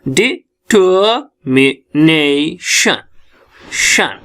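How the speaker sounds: "Determination" very slowly, di - tuh - mi - nay - shun; syllable by syllable. Shown as a demonstration. Teacher checks with class what it is, "determination" split into slow syllables. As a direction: break "determination" into drawn-out syllables with gaps. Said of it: "क्या है ये?".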